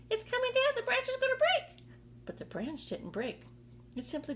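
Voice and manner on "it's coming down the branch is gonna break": very high, loud shriek of alarm; shouting aunt. Acted. Aunt Sponge warning as the branch bends, high loud shout. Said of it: "Aunt Sponge shouted".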